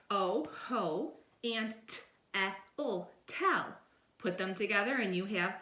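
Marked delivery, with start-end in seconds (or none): 0.1-3.7 ho, t, eh, ul, tell sounded out piece by piece; sounding out syllables. Shown as a demonstration. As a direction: sound out each part slowly. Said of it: "We have".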